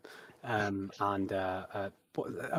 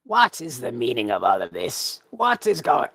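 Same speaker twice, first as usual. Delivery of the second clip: indignant demand; 6 dB louder than usual. Acted. Commander storming in on the scene, loud and outraged. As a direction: bellow it like an angry commander. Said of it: "he goes".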